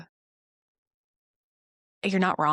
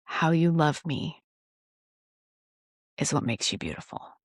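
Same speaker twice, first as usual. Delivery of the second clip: tender whisper. Retold or imitated. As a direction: hush it like a loving secret. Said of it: "the sweet whisper he said".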